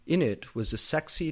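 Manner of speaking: ordinary delivery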